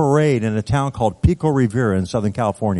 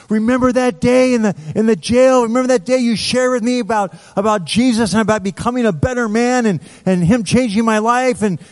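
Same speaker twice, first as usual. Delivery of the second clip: high, eager retelling; grateful excitement; 9 semitones higher than usual. Retold or imitated. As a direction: pour out your thanks in a rush of memory. Grateful former inmate recalls jail talk.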